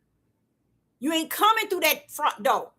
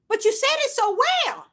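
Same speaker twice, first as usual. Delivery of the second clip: much higher, bright with excitement; delighted; 12 semitones higher than usual. Real, not acted. Preacher praising a listener, delighted. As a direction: gush with delight.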